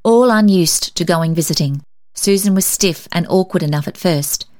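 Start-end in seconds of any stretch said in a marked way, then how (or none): none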